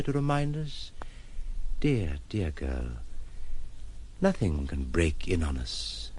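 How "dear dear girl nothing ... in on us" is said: low, slow and tender; tender comfort. Acted. Man soothing the troubled woman, low and tender. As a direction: soothe her gently.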